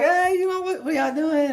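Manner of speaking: very high, mock-casual greeting; hammed-up nothing's-wrong voice; faking cheerful. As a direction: put on a bright hello to hide the tears. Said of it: "like you weren't crying all day".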